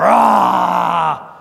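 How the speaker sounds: mock roar of the devil; playful pulpit roar. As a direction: growl it out like a devil. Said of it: "And then the devil roars".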